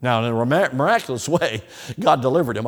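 loud ringing sermon voice; preaching at full force. Acted. Preacher telling the congregation of a miracle, loud. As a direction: proclaim it to the pews.

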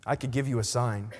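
low, gentle reassurance; tender. A narrator's reading. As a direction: speak softly, as a loving master. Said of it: "And he tenderly said".

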